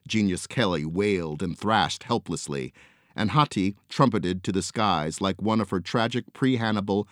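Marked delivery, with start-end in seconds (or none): none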